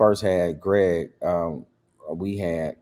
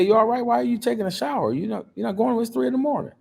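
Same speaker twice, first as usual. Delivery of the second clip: worried question; 14 semitones higher, faster than usual. Retold or imitated. Asking wife worriedly at 3am.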